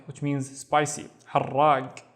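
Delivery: usual speaking voice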